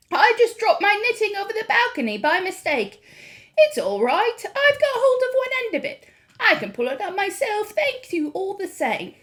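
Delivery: put-on calm, high old lady voice; cool grandmother. A narrator's reading. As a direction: stay perfectly cool and polite. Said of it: "coolness of her voice".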